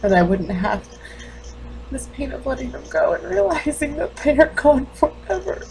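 high, strained grief with long halting pauses; grieving, guilt-stricken mother. Acted. Mother realizing the children are gone forever, anguished. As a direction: let the pitch climb into self-blame. Character grieves and blames herself.

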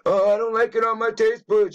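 high mocking whine; mocking whiny voice. Mocking the fussy spoon-takers in a high whine.

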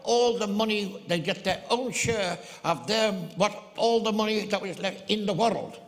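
high, loud lilting Irish brogue; Irish accent. Retold or imitated. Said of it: "this little Irishman".

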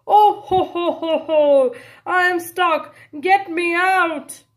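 loud, booming ho ho ho into a cry for help; Santa's shout. Acted. Santa stuck in the chimney bellows out. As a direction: laugh then shout, stuck. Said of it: "turns to a shout".

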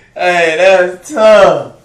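slow, loud and drawn out. Real, not acted. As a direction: stretch every word, loudly.

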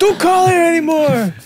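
fuori di sé. Retold or imitated. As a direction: yell at a prank caller, hammed up. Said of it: "the person would lose their minds".

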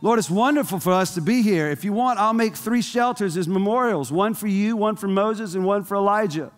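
high-pitched, over-eager disciple; eager Peter. Acted. Chatty disciple offering Jesus three shelters, eagerly. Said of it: "always open your mouth".

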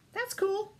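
a silly high little reassurance; playful squeak. Acted. Playful aside to kids.

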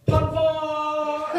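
high, loud sung-out yell of "Papa!". Retold or imitated. Tim Russ yelling mid-take to shock the crew. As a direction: belt out "Papa!" like a wild cry. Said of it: "and he yells".

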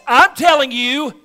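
commanding slow declaration; Jesus declares. Retold or imitated. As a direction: ring out the stones line with authority. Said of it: "He simply says".

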